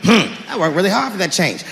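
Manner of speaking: ordinary talk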